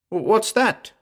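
high-pitched, stammered alarm; startled yell. A narrator's reading. Frightened man hearing a stranger's voice, yelling. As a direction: yelp it in sudden fright. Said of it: "I yelled".

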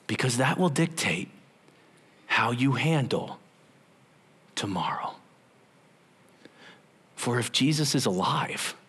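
ordinary delivery

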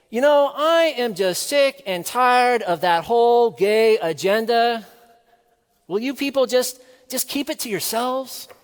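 loud, high, exasperated complaint; fed-up outburst. Retold or imitated. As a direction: imitate an angry heckler at the mic. Fed-up audience member's outburst.

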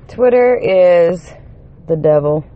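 slow, loud, weighty pronouncement; every word hammered slowly; emphatic declaration. Real, not acted. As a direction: drop it like a sermon. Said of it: "let me just say to anybody".

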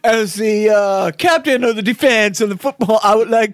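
high put-on sketch-character voice; Bill Murray. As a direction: imitate a comic football captain addressing the crowd.

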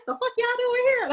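high, irritated snap at his rescuers; irritated Montrose. Retold or imitated. Montrose annoyed at his rescuers, high irritated voice. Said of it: "He turned around so irritated".

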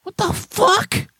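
high, slow incredulous shout; mock outrage. Real, not acted. Host reacting to bored retiree, high and incredulous. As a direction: throw up your hands. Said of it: "I'm pretending".

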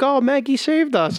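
high, drawn-out grateful cry; mock relief. Kids waking from the dream, high mock relief.

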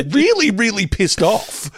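high, slow, laughing emphasis; worked-up retelling through laughter; mock pissed off. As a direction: stretch it out with amused outrage. Describing anger felt in dream, laughing.

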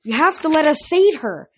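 loud, high pleading; desperate plea. A narrator's reading. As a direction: beg desperately.

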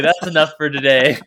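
loud, fed-up announcement; mock rage-quit declaration; fed-up quitting. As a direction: slam the lid and declare it done. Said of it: "I'm like".

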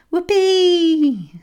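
high, loud gleeful squeal; delighted squeal. A narrator's reading. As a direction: squeal with joy as the bike speeds up.

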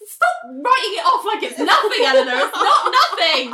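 high-pitched protest breaking into laughter; exasperated, giggly defense of a crush; playful indignation. Real, not acted. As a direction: scold a friend in laughing outrage. Playfully scolds co-host, laughing.

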